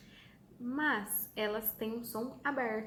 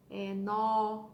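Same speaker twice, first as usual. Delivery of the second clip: nó louder, open ó; open strong sound; 5 dB louder than usual. Shown as a demonstration. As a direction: open wide on nó. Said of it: "a gente abre a boca bem pra falar".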